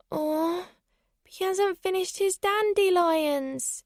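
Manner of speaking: put-on small, high child's voice; whining little girl. A narrator's reading. Little girl reluctant to give back rabbit, high whine. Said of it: "and she continued to whine".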